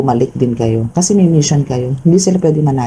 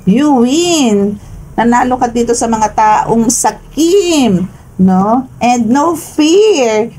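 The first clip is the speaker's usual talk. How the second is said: triumphant; 8 semitones higher, slower than usual. Real, not acted. Triumphant tarot message.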